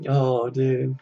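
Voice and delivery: usual speaking voice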